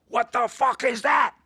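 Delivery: slow, high-pitched furious shout; yelling professor. Retold or imitated. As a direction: bellow it like an outraged art professor. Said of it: "He starts yelling".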